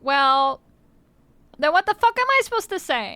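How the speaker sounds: rising, fast, fed-up comeback; exasperated retort. Retold or imitated. Corrected about saying bless you, fires back exasperated. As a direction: snap back in mock outrage. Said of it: "And I'm like".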